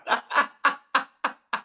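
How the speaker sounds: high real laughing fit; helpless laugh. Real, not acted. Comedian tickled by own excuse, high helpless laugh. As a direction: crack up in high, breathless bursts.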